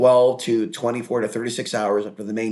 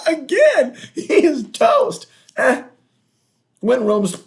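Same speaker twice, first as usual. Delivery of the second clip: laughing; 14 semitones higher, 5 dB louder, slower than usual. Real, not acted. Laughing while trashing a rival.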